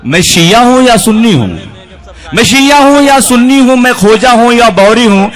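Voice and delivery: usual speaking voice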